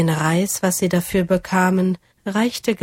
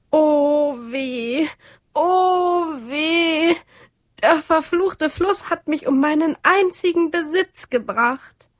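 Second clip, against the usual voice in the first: much higher, hammed-up wailing cry; loud fake lament; 9 semitones higher than usual. A narrator's reading. Greedy neighbor faking a loss at the river, loud wail. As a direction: overact the grief to lure the dragon. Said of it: "erhob er ein lautes Geschrei".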